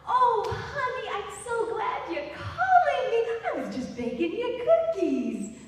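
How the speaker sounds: high sugary grandma coo; sweet American grandma. Retold or imitated. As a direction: gush like a cookie-baking grandma. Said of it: "like an American grandma".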